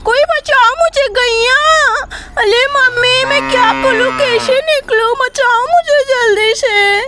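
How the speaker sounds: put-on high, tearful little girl calling for mum; a trapped child sobbing and begging to be saved; frightened child voice, pleading; child crying for help. Child stuck in pot calling mum.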